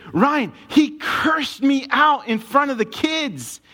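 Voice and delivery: acting the upset wife, high and drawn out; high, slow, indignant complaint; retold upset wife. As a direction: imitate an outraged wife. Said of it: "She's angry".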